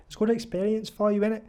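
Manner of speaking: attempted Liverpudlian salesman; Liverpudlian accent. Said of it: "I can't really do a Liverpudlian accent".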